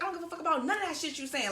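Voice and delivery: high, fast heated comeback; fed-up retort while he brags about girls; going hard. Retold or imitated. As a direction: shut him down fast. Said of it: "I'm going hard".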